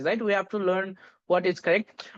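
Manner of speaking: ordinary talk, nothing marked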